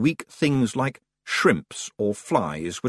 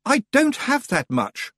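high, slower mock shout; slower than usual. Roland protests he cannot pay, shouting. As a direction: protest in a lad's voice. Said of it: "Roland shouted".

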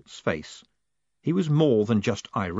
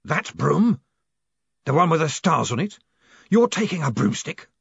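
sharp surprise; 6 semitones higher, 4 dB louder than usual. Acted. Gilt looking up sharply.

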